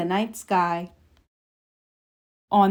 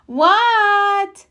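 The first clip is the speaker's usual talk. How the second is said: shouted what; 11 semitones higher, 4 dB louder than usual. A narrator's reading. Child answering call.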